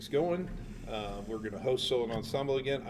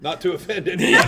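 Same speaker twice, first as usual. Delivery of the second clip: loud, laughing repeat; laughing shout; 4 semitones higher, 11 dB louder, faster than usual. Real, not acted. Repeating his own disclaimer, loud and laughing. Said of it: "laughing shout repeating line".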